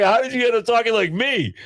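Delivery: very high mock-baffled question; incredulous. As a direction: demand an explanation in squeaky disbelief. Retold shock at son's voice.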